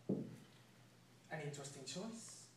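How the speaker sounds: low, slow, quiet menace. Acted. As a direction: savour it slowly and quietly. Villain savours the dilemma.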